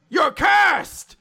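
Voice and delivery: high, loud mock accusation; accusing shout. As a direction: yell it out as a hammed-up denunciation. Acts out Langris's accusation.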